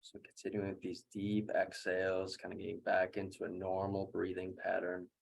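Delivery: quiet, level coaching through recovery; guided breathwork. Real, not acted. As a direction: talk them gently down after the breathing. Guides recovery after second round.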